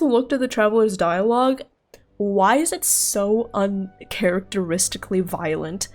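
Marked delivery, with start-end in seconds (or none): none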